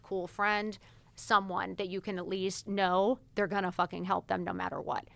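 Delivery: ordinary talk, nothing marked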